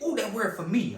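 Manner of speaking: high, loud outburst; mock excited claim. Imagined excited claim of the word.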